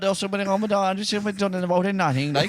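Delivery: a lighter Kerry brogue, casually done; rambling Kerry country man; Kerry neighbour accent. Retold or imitated. The neighbour from the RTE show, mild Kerry accent. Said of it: "The other fella then you can't understand".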